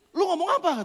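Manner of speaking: tiruan gertakan, lebih keras dan tinggi; menirukan orang marah. Retold or imitated. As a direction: tegur dengan nada menantang. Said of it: "Terus dia marah". (menirukan lawan yang marah)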